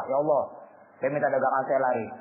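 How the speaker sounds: humble prayer. Retold or imitated. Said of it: "Mas Wada doa".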